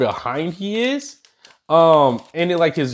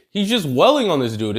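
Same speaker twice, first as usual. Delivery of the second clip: normal talking voice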